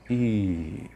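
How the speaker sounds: "I" stretched long and big; exaggerated vowel. Shown as a demonstration. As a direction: blow the vowel "I" up huge for a child. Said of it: "E aí vem o exagero da vogal". (vogal "I" exagerada e alongada)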